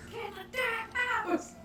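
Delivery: mock scolding. Imitates scolding neighbour lady.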